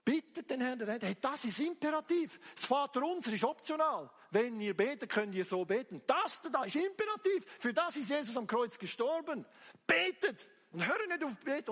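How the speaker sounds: loud, high-pitched exhortation; fiery preaching. Real, not acted. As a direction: drive the command home at full force. Exhorts the congregation at full force. (feurig und laut gepredigt)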